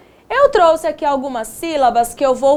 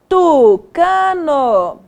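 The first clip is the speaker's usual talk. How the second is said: "Tucano" very slow, each syllable stretched; sounding out; much slower than usual. Shown as a demonstration. Teacher sounding out word.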